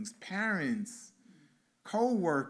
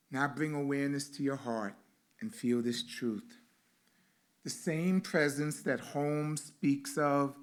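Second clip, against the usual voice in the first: guided meditation. Acted. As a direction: lead the congregation into stillness gently.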